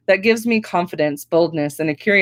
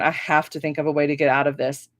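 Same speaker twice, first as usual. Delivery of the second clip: ordinary delivery, nothing marked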